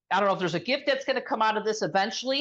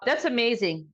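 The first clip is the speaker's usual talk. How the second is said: ordinary talk, nothing marked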